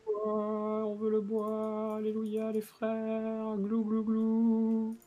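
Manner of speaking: very high, slow gobbling chant; squeaky creature chanting for wood; gobbling turkey voice. Pretend. Silly chant about wood.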